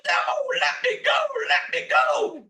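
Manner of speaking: very high, loud squealing demon; little demon pleading. A narrator's reading. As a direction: squeal to be released from the rocking chair. Trapped little demon begs for release.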